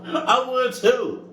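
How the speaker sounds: high, slow words through laughter; laughing. Real, not acted. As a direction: laugh while agreeing. Laughing reaction to scary story.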